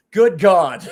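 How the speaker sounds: a loud, startled "good God" from a sold-out vendor; mock shocked exclamation. Knife vendor to his friend, in a loud mock-stunned outburst. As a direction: blurt it out like the joke just hit him. Vendor realizing he's been had.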